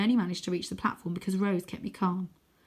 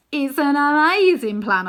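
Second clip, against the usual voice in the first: boastful; 8 semitones higher, 11 dB louder, slower than usual. Acted. Wyn boasting.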